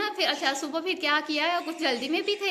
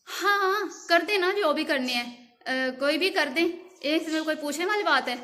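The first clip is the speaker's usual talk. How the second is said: imitating a husband snapping back, higher and louder; retold irritated shout; 4 semitones higher, 5 dB louder than usual. Husband, distracted by a TV show, yells back at his wife, loud and irritated. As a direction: raise the voice like he's annoyed at being asked.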